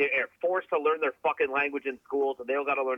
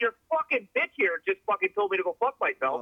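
higher-pitched indignant retort, retold; heated, high-pitched retelling of an argument; 8 semitones higher than usual. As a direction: act out complaining to the manager mid-argument. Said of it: "this huge fucking argument".

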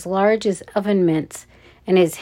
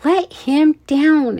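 high, loud, drawn-out demand; 8 semitones higher, 5 dB louder, slower than usual. A narrator's reading. Ella as Tank carries Noah off, demanding. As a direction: insist on it, each word leaned on. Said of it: "Ella demanded".

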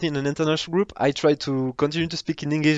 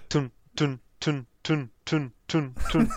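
choppy tun sounds; 3 dB quieter, slower than usual. Shown as a demonstration. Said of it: "How can I say?".

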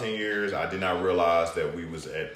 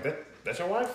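much higher, incredulous mock question; retold amazement; 13 semitones higher than usual. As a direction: imitate someone amazed, pitch jumping up. Retelling an acquaintance's amazed question.

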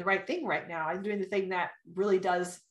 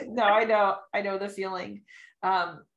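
ordinary talk, nothing marked

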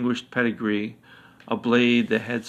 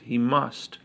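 ordinary delivery, nothing marked

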